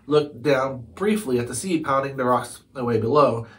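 plain reading voice

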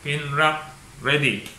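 normal talking voice

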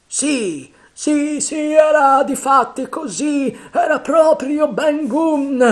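high, loud, slow emphatic agreement; pirate captain seizing on the name; eager Silver. Acted. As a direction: drag each word out, convinced.

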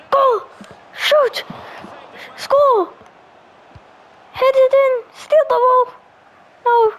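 high, urgent calls to players; excited urging. Real, not acted. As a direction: urge them on, pitch up.

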